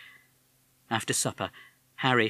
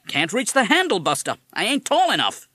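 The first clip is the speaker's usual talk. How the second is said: put-on higher, louder parrot with a tough American accent; 12 semitones higher, 8 dB louder than usual. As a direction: talk like a fast-talking American wise guy. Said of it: "You have an American accent".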